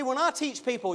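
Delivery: normal talking voice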